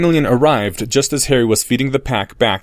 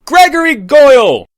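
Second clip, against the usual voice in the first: much higher, far louder scream of a name; 9 dB louder than usual. Acted. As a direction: scream the name across the field. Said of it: "screamed Harry".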